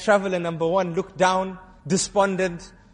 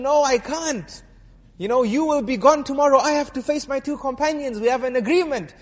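pleading refusal; 6 semitones higher than usual. Acted.

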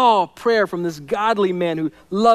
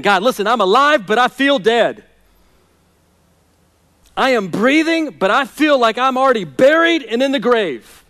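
preaching a despairing prayer, higher and louder; anguished prayer; 5 semitones higher, 5 dB louder than usual. Acted. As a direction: cry out to God as a man who feels dead. Voices the psalmist's prayer.

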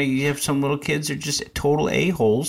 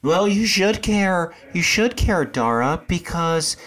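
mock patient parent; 6 semitones higher than usual. Acting a parent correcting Dara, high patient coaxing. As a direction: reason with a small child in a lifted voice.

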